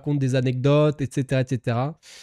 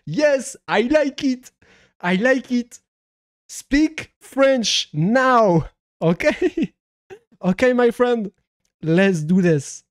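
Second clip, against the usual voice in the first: put-on high, drawn-out French-accented English; hammed-up French-accented English; 7 semitones higher, much slower than usual. Streamer telling a foreign viewer to speak French, mock-bossy French accent. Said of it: "de mon accent anglais".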